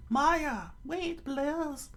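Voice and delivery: high falsetto plea; Nami-style anxious appeal; pleading young woman. Acted. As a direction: beg urgently in a put-on high voice. Nami pleads with charging mayor.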